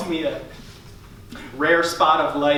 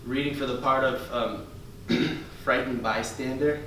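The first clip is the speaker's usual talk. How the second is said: soft, lower, hesitant auditioner; timid actor character; 4 semitones lower, 7 dB quieter than usual. Acted. Comic plays shy actor auditioning, soft and hesitant. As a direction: shrink into a nervous audition voice.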